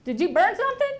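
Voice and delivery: calling to daughter in the next room, a mock yell; retold yell. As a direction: shout it through the house at your daughter. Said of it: "And I yell to her".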